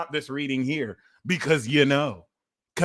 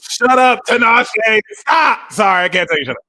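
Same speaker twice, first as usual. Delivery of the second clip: high, loud shout; 9 semitones higher, 11 dB louder than usual. Real, not acted. Yelling shut up at the clip. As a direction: yell it at the screen.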